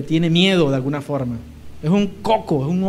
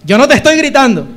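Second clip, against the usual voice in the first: loud, higher protest; wife shouting back; 5 semitones higher, 9 dB louder than usual. Retold or imitated. Wife yelling that she is not yelling, loud. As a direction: snap back loudly while denying it. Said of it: "me dice ella".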